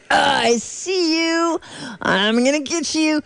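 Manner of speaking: slow, teasing villain threat; menacing sun voice. Acted. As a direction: taunt the tiny probe slowly.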